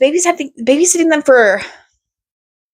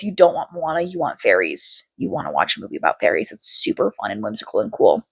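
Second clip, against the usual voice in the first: low, sinking hypnotist-like coaxing; mock brainwashing murmur, trailing down very low; manipulative persuasion; 9 semitones lower, 4 dB quieter than usual. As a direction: drop low and plant the idea in their heads. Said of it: "me manipulating these kids".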